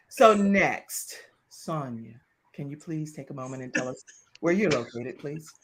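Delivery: soft, low, measured host; hushed, over-polite refined request; put-on distinguished voice. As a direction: gently imitate a sultry, composed presenter. Host puts on the envisioned smooth voice.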